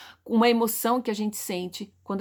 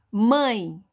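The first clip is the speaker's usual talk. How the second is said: "Mãe" as one firm, falling beat; one single sound; 4 dB louder than usual. Shown as a demonstration. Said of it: "um único som".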